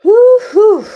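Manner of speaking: loud, high whoop of triumph; joyful cheer. Real, not acted. Healer cheering as the last curse clears, loud and high. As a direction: cheer like you just won.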